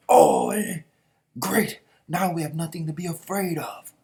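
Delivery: loud, high rally to the troops; battle rallying cry. Acted. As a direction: bellow the war cry, then rouse everyone.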